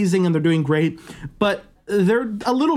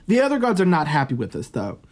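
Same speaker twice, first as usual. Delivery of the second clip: ordinary delivery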